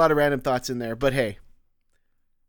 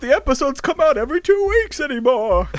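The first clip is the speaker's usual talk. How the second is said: whiny fan voice; 10 semitones higher, 4 dB louder than usual. Pretend. Mock fan complaint.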